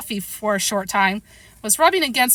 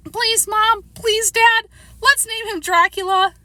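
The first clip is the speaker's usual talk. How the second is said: put-on very high, loud little-boy plea; crying out, begging; 13 semitones higher, 4 dB louder, slower than usual. A narrator's reading. Boy begging Mom and Dad over a pet's name, high pleading cry. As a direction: beg both parents in a high kid voice. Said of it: "cried Toby".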